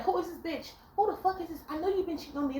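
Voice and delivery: high, hammed-up accusing shriek; mock jealous rant; mock jealous confrontation. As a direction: act out the crazy girlfriend. Woman confronting man in restaurant.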